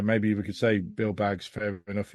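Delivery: normal talking voice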